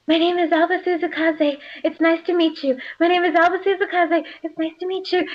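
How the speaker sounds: high-pitched heroine drilling her lines; Alba practicing her introduction. Acted. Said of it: "muttering to herself and practicing".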